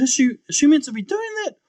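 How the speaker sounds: high, loud, urgent question; alarmed questioning. Retold or imitated. Brother asking nurse about his walking sister, alarmed. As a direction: raise pitch and volume in disbelief.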